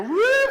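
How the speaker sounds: high, loud whooping cheer; cheer woo. Shown as a demonstration. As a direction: show them the big woo. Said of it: "we're gonna go".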